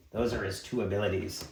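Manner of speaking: ordinary talk, nothing marked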